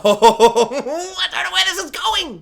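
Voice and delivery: thrilled not to know what comes next; excited laughing. Shown as a demonstration. Fan delighted by the unpredictable plot, high laughing glee. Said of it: "It's more like a".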